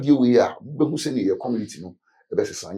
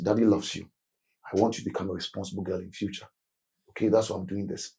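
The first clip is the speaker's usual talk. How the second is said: soft, low reassurance to a weeping daughter; a father comforting his daughter quietly after punishment; tender father; 6 semitones lower, 10 dB quieter, faster than usual. Retold or imitated. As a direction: draw her close and soothe her gently. Father soothing child after whipping.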